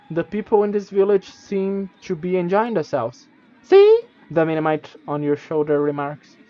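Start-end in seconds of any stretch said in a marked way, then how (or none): 3.6-4.0 very high, loud chirp; a tiny told-you-so; smug creature. Acted. Minimite shows the traveler it was right, high and loud.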